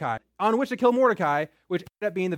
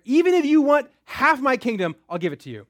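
booming royal offer, raised and loud; a king promising anything to his queen; grand generous king; 6 semitones higher, 6 dB louder than usual. Acted. King tells Esther she may have half his kingdom, grand and loud.

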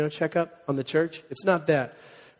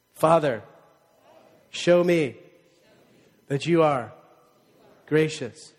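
led prayer; 3 semitones lower than usual. Shown as a demonstration. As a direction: pray it phrase by phrase so they can repeat. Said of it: "You guys just repeat after me".

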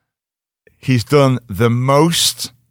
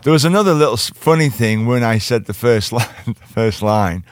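normal talking voice

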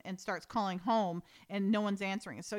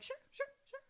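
squeaky, much higher chorus of agreement; mock sheepish agreement; 11 dB quieter, faster than usual. Three chastened people agree at once, in a squeaky mock voice. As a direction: play all three, meek and tiny.

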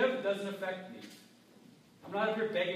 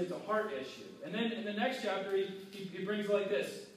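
ordinary talk

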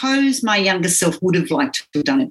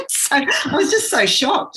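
said through laughter, higher and slower; laughing through it; 10 semitones higher than usual. Real, not acted. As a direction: still laughing as she says it. Laughing while recalling shock.

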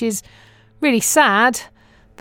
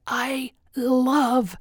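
enthusiastic American man; 4 semitones higher than usual. Retold or imitated. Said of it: "he said".

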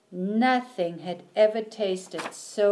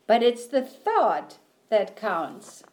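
plain reading voice

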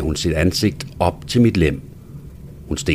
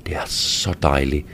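soft pleasured whisper; hushed whisper close to her ear; 5 semitones lower, 5 dB quieter than usual. A narrator's reading. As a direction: breathe it out as a low whisper. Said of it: "hvisker jeg".

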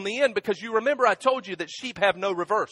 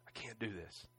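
broken whisper; 9 semitones lower, 17 dB quieter than usual. Retold or imitated. Preacher retelling what he told Kim after grandma died, broken whisper. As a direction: fade to a stunned, exhausted near-whisper. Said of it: "telling Kim".

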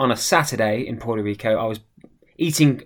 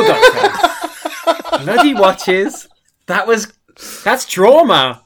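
laughing it off, slowly; 8 semitones higher, much slower than usual. Real, not acted. Laughing over the memory.

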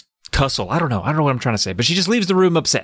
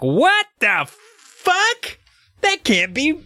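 very high, drawn-out squeal; mock outraged disbelief; 14 semitones higher, much slower than usual. Co-host as Gary hearing the news, high mock disbelief. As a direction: stretch it out in hammed-up shock. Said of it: "Gary's all like".

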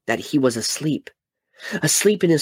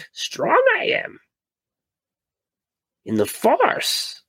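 put-on much higher, slow little old Jedi master voice; Yoda; slower than usual. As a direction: croak it out slowly like tiny old Yoda. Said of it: "Yoda on his deathbed".

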